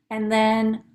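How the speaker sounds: ordinary delivery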